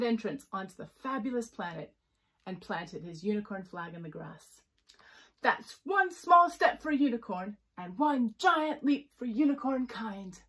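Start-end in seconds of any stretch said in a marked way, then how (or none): 5.4-10.4 slow, loud, pompous speech; grand proclamation. Acted. Unicorn plants a flag, proclaiming grandly. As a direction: declaim it like a historic moment.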